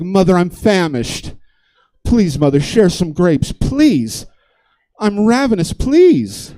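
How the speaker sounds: put-on high child voice, pleading harder each time; desperate well-spoken kid. Well-spoken kid begging mother for grapes, mounting desperation. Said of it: "got this hint of desperation in their voice".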